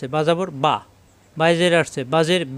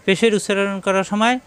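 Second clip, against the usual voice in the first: ordinary talk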